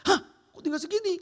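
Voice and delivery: high, loud startled gasp of a question; shocked. Retold or imitated. Wife shocked at the bank balance, surprised. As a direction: react like seeing an empty account.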